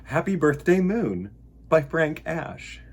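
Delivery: usual speaking voice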